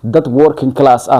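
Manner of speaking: ordinary talk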